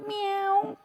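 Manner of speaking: high falsetto meow; cat meow demo above the pencil; high kitty meow. Shown as a demonstration. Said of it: "sound of a kitty cat".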